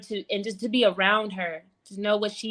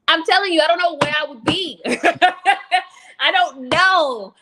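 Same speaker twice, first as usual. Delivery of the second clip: higher and louder, laughing through the words; laughing with emotion; 8 semitones higher, 7 dB louder than usual. Real, not acted. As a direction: laugh it out, overwhelmed with gratitude. Overwhelmed gratitude, laughing through it.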